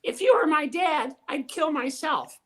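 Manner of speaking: high ventriloquist dummy voice; puppet voice. Acted. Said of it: "the puppet says".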